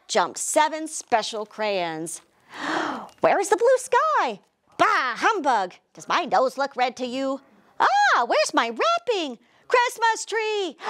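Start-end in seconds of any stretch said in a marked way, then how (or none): 2.5-3.2 soft surprised gasp; a quick startled intake of breath. Acted. Crayons come alive. 3.2-10.8 high squeaky little crayon voices. Acted. Crayons chattering.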